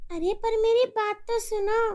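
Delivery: making shopping plans with a friend; chatty mother on the phone. Acted. Mother protests.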